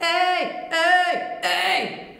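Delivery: high, distorted overdrive shouts; dark-coloured, gritty high yell; heavy metal distortion. Shown as a demonstration. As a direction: traditional heavy metal "hey". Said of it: "I'd add some distortion mostly".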